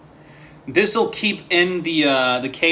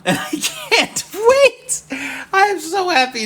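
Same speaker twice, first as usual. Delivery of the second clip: laughing excitement; 13 semitones higher, 7 dB louder than usual. Real, not acted. Excited about mic arm.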